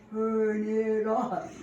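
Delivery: very slow drawn-out call, as if heard from far off; muffled and slowed. Retold or imitated. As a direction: stretch it like a dazed memory. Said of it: "I was still hearing".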